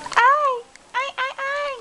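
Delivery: startled cry. Real, not acted. Startled by the baby.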